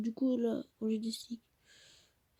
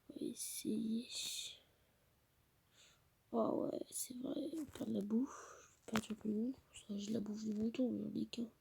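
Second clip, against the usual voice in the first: whispering; 7 dB quieter than usual. Real, not acted. Whispering, mother downstairs.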